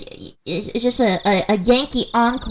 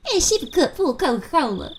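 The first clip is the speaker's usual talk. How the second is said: higher-pitched mock Southern voice; Southern accent; 6 semitones higher than usual. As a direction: tease with a put-on Southern twang. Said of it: "Southern is, um, Southern is".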